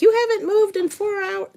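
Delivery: robotic device voice. Retold or imitated. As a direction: voice the fitness tracker like a chirpy machine nagging you. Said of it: "they're like".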